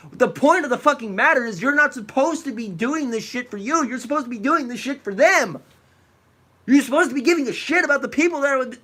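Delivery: loud, high, furious yelling; furious shouting. Real, not acted. Streamer yelling at the YouTuber in the clip, furious. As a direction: tear into him at full volume.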